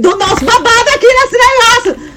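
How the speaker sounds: high-pitched excited cry to friends; rally the friends in a rush of excitement; excited discovery. Retold or imitated. Friends discovering their kind of dance floor, excited.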